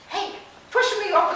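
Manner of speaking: loud urging. Retold or imitated. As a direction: shove her along with a loud high voice. Friend urges her to go.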